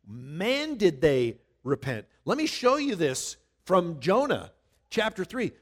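ordinary delivery

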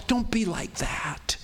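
unvoiced, slow whisper of a warning; whispered plea. God's whispered warning to stubborn listeners. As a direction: breathe it out slowly as God's gentle warning. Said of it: "God is saying to you and me".

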